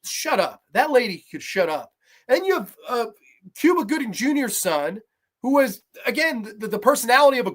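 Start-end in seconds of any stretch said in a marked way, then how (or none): none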